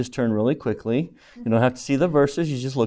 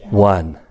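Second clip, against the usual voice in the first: One said alone, louder and lower, like an answer; emphasis on one; 5 semitones lower, 5 dB louder than usual. Shown as a demonstration. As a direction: land hard on One. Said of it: "Emphasis on which word?".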